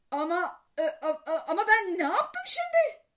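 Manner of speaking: stammering, rising to loud and high; flustered protest. A narrator's reading. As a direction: protest your innocence to a crying wife. Baba ağlayan anneye kendini savunuyor. (şaşkın, kekeleyerek itiraz)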